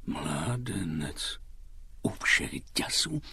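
slow, hushed thinking aloud; low-volume, drawn-out pondering; puzzled musing. Acted. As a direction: mutter it to yourself, searching your memory. Holmes puzzles over a familiar voice.

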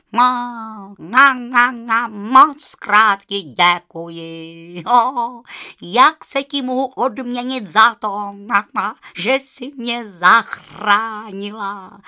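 loud, honking goose voice with na-na stutter. Acted. The freed goose thanks Rozárka, loud honking goose voice. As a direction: cackle the thanks like a happy goose. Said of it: "zakýhala husa".

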